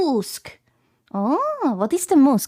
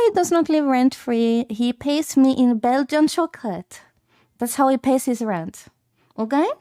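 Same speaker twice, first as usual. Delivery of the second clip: normal talking voice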